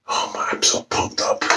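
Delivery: whispered, breathless excitement; excited whisper. Real, not acted. Vlogger whispering his excitement to viewers. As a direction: whisper it like a thrilled secret.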